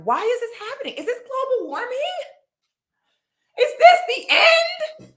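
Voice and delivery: squeaky mock doom about the heat; mock panic. Real, not acted. Mock panic about global warming.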